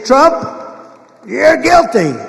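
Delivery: loud, high-pitched mock pronouncement; mock verdict. Judges ruling against the defendant, mock loud verdict. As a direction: declare it loudly, imitating a judge.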